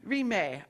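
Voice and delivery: Rime said as REE-may, falling; corrected pronunciation. Real, not acted.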